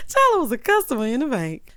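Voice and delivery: tickled, laughter in the voice; still laughing. Real, not acted. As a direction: gasp it out after a laughing fit. Talking on out of laughing fit.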